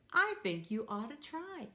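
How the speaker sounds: ordinary talk, nothing marked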